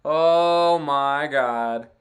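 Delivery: slow, loud, drawn-out disbelief; stunned. Real, not acted. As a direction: let it sink in, aghast. Reacting to Oilers blown lead.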